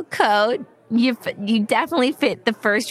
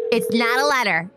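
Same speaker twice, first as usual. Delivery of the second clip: ordinary delivery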